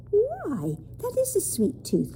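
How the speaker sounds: high, bright surprised exclamation. A narrator's reading. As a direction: lift it in amazement. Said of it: "Lindy said in surprise".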